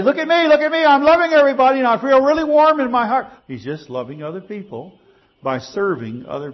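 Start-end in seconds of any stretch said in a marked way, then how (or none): 0.0-3.3 much higher, louder, boastful sing-song; preacher mocking a boastful helper, crowing; mock show-off. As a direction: crow for attention like a show-off.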